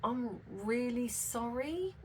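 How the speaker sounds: soft, slow and sheepish apology; gone quiet. Retold or imitated. To the Toyota man after realising she rang the wrong garage, quiet and slow. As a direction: drop right down and murmur it slowly, deflated. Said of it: "So I just sort of went quiet".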